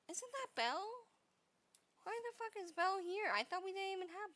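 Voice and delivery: high, fast surprised exclaim. Real, not acted. Surprised to see Belle appear.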